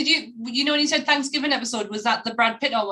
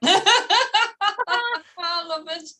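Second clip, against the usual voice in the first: laughing; 6 semitones higher, much slower than usual. Real, not acted. Laughing delight.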